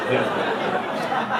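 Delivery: ordinary talk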